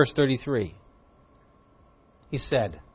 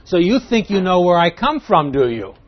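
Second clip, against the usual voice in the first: raised, loud mock challenge; facetious; 5 semitones higher, 7 dB louder than usual. Jesus doubting they know his origin, facetious. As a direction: needle them with pretend surprise, then dismiss. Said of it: "that's speaking facetiously".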